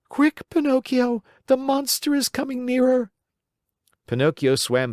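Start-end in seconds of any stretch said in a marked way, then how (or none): 0.1-3.1 much higher, pleading little goat voice; bleating goat. Acted. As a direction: beg him to hurry like a bleating goat. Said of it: "bleated the little goat".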